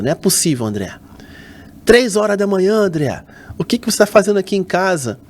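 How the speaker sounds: mother's startled scolding. Retold or imitated.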